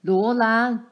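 loud, stern call of a name; an angry father calling out; father calls his daughter to account, loud and stern; stern shout. A narrator's reading. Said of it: "爸的声音可怕极了".

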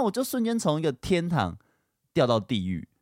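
ordinary delivery

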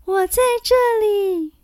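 thin high little voice; small piping spirit voice. A narrator's reading. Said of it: "细细的声音飘出来".

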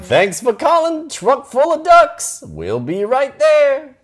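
high, slow, sing-song phone greeting; chirpy commercial receptionist; cheery ad voice. Pretend. Reading the ad's phone greeting.

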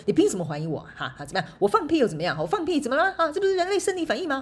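hammed-up indignant boss shouting back; mock yelling defense, pitch climbing. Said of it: "他可能就大吼大叫".

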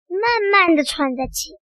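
ordinary delivery

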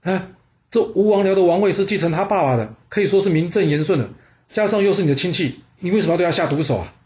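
jolted and alarmed, pushing back; a shocked butcher questioning the prince; startled. A narrator's reading. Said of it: "他吓了一大跳".

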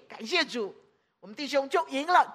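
ordinary talk

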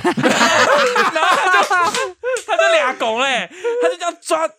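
high, slow laughing recall; laughing narration. Real, not acted. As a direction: laugh through the scary part.

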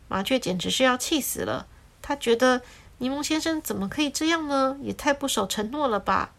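usual speaking voice

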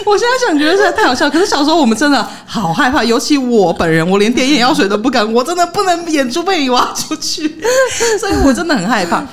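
high, giggling through the words; real laughter, voice up high; laughing while telling. Real, not acted. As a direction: keep laughing as you admit the fear. Laughing while recalling fear.

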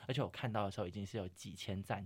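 usual speaking voice

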